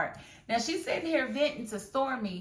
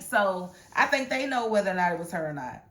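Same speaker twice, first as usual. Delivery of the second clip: ordinary talk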